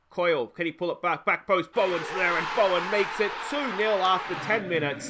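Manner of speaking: rising into a loud, high shout; excited goal shout. Real, not acted. Manager calling the second goal for his viewers, loud shout. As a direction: yell it as the ball goes in.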